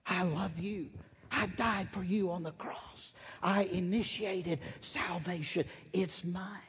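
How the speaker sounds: a touch of a tender father's voice; tender divine voice. Retold or imitated. God speaking to wayward children.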